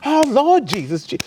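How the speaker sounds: shrieked very high and loud to Jesus; Holy Ghost meltdown. Retold or imitated. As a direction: wail it like an old lady in religious ecstasy. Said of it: "she went into a Holy Ghost meltdown".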